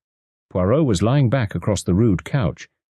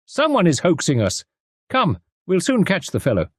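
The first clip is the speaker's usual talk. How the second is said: Poirot repeating the cry; 11 semitones higher than usual. A narrator's reading. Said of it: "he cried".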